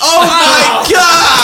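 high, loud, drawn-out gasp of shock; shocked shout. Real, not acted. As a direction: yell it in disbelief. Shocked by the near-swear guess.

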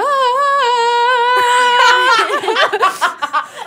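high shrieking laugh; loud laughing. Real, not acted. Judge bursts out laughing.

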